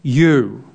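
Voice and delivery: YOU given firm, full weight; a strong, stressed YOU; emphatic YOU. Shown as a demonstration. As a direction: hit the word YOU strongly. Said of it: "The emphasis is you".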